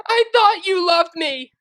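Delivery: high, loud anguished wail. Acted. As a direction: wail it in heartbreak. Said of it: "she wailed".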